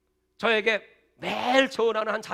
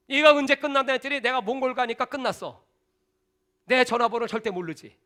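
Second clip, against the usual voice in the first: ordinary talk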